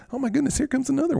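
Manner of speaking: high, fast and delighted; excited. Real, not acted. Seeing another lamb arrive, excited and high. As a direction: squeal with delight.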